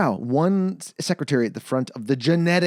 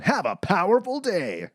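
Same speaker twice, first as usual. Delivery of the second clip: imitated building announcement; 6 semitones higher than usual. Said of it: "the voiceover in the building going".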